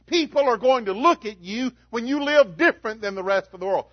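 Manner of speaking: loud, high, driving sermon voice; fiery preaching. Acted. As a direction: preach it out to the congregation full force. Preaching full force sermon.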